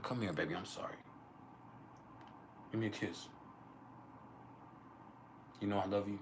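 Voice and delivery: soft, coaxing sweet talk to make up. Pretend. Sweet-talking his partner after a spat, soft and coaxing. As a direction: murmur it softly like making up with her. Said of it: "you gotta get sweet on it".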